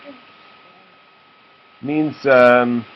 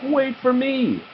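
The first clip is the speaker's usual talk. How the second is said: high little piglet call; a small piglet left behind crying out; 14 semitones higher, slower than usual. A narrator's reading. Piglet shout in story.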